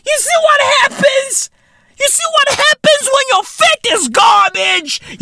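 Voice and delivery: comic high squawk; hammed-up, squealing indignation; high-pitched mock outrage. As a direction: jump way up in pitch to rant. Comic rant building to outburst.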